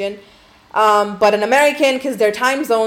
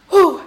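big exhale; 9 semitones higher, 7 dB louder than usual. Real, not acted. Bracing for worse part.

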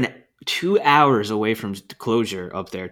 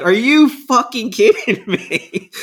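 high, slow, laughing disbelief; cracking up in shocked disbelief; 10 semitones higher, 4 dB louder, slower than usual. Retold or imitated. Hunter to buddy at the clock, laughing in disbelief.

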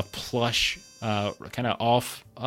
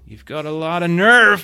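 angry gangster; 7 semitones higher, 8 dB louder than usual. Acted. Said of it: "he's like".

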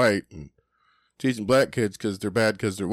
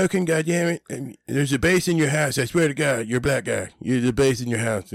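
raised mock Cartman voice, bossy; Cartman impression; 5 semitones higher than usual. Retelling South Park to a friend, bossy Cartman voice. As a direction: bark at Token like Cartman. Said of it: "and he's like".

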